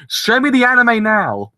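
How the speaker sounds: high, loud, slow yelled demand; mock demand. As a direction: shout it at the studio doors. Demanding anime outside studio.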